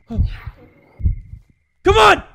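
ordinary talk

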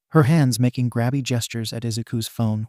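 usual speaking voice

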